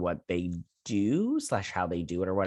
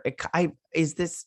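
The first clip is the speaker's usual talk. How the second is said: usual speaking voice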